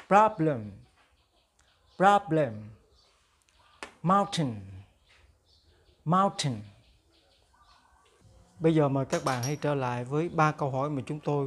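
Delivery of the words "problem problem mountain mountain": slow, careful phrase for students to echo; slow model for repeating. Shown as a demonstration. Teacher drilling vocabulary for the class to repeat. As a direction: say each phrase slowly, twice, to be copied. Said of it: "Now repeat after me".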